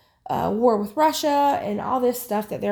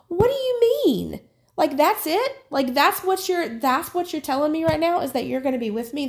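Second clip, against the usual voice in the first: high-pitched, indignant challenge; angry disbelief; 6 semitones higher than usual. Retold or imitated. Retelling her reply to God's promise, angry disbelief. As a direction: recreate an outraged demand for a better answer. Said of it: "I was angry at God".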